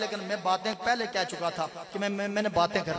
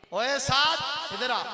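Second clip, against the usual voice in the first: put-on loud, higher, curt calling of a boy; rough call; 9 semitones higher, 8 dB louder than usual. Shown as a demonstration. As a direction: bark his name across the room. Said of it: "کوئی نہیں".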